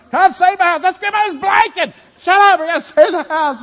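high, loud panicked shout; frantic yelling. Retold or imitated. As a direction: yell it while stomping on towels, desperate. Frantic yelling saving house.

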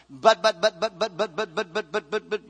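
rapid repeated but, but like an engine sputtering; preacher imitating a motorboat with stammered buts; stammering motorboat. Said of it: "that comes to my brain".

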